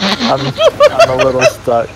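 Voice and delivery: giggling through the words; laughing. Real, not acted. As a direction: laugh it out, high. Laughing while stuck.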